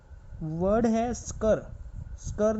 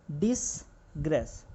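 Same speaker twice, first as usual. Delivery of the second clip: Disgrace stretched slowly, syllable by syllable, while writing; slow drawn-out word; 4 semitones lower, much slower than usual. Shown as a demonstration. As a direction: drag out disgrace as you write it. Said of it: "next word है". (Disgrace को धीरे-धीरे खींचकर बोलना)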